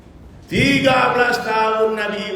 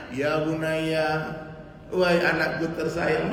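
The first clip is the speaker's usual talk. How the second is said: soft, very slow, lovingly drawn out; tender call to his son; 5 semitones lower, 5 dB quieter, slower than usual. Retold or imitated. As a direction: call the son gently, like a doting father. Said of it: "disayang-sayang, kalau manggil anaknya". (memanggil anak dengan lembut dan sangat pelan)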